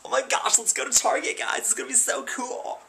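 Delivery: high, squealing overexcited gush; high hammed-up excitement; mock excitement. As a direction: gush like Target is a theme park. Overexcited about Target trip.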